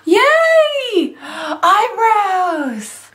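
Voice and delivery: thrilled cheer. Real, not acted. Relieved her eyebrows survived, she cheers in a high squeal. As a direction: cheer as if you'd won.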